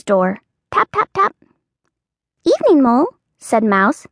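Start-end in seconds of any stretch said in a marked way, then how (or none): 0.7-1.4 high light tapping; knocking sounds. Acted. Knocking on Mouse's door. 2.4-3.1 mouse voice. Acted. Mouse character voice.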